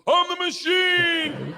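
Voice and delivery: put-on high, slow, drunken-party holler; triumphant bellow. Student drinking all night with gangsters, triumphant bellow. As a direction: stretch it into a long proud yell. Only phrase he knew, yelled drinking.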